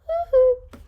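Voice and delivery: high, loud wild whoop; crazed whoop. Acted. Coach cracking up in the teachers' lounge, high loud whoop. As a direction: whoop like a man who has cracked up. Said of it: "That means he went".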